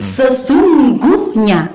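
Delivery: SESUNGGUHNYA very slow, each syllable held; stress on consonants. Shown as a demonstration. Teacher showing where consonants carry stress, word stretched slowly. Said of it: "Ada huruf konsonan di dalamnya".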